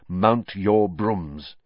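very slow, drawn-out command. Acted. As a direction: stretch out the order slowly. Referee's command.

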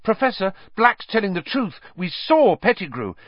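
high, urgent, tumbling outburst; bursting out. A narrator's reading. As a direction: blurt it all out, pitched high. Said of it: "They both burst into speech".

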